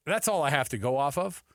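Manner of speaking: ordinary talk, nothing marked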